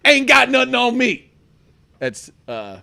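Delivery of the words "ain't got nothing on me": lightly voiced Denzel, higher and louder; a hint of a cocky Denzel brag; Denzel impression. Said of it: "little Denzel for you guys".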